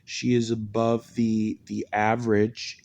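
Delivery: ordinary delivery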